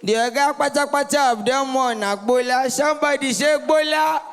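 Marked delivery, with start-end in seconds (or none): 2.7-4.2 crowd call-out. Real, not acted. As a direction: hype the crowd to shout back. Calling on crowd to respond.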